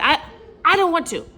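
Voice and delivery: high, loud whiny "I don't want to"; mock-sulky refusal, half laughing. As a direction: whine it like a sulky child. Retold refusal to husband.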